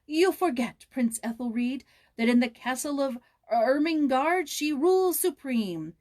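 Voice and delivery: loud, high, grand make-believe queen; a girl acting a regal queen to cheer her playmate; theatrical voice. A narrator's reading. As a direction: play the ruling queen of the castle, grandly. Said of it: "said in a theatrical voice".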